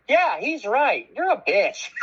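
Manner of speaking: slow, high-pitched put-down; imitated scolding mom. Mom turns on her own daughter, imitated scolding. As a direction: mimic the mom in a high, drawn-out voice.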